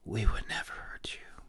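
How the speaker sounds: fairy reassuring him in a whisper; whispered reassurance. A narrator's reading. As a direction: breathe it gently as a promise. Continues whispering.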